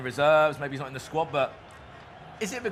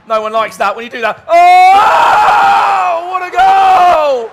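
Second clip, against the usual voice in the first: screaming goal call, much higher and louder; goal call shout; 8 semitones higher, 15 dB louder, slower than usual. Real, not acted. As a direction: scream the goal with total excitement. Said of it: "I'm losing my voice".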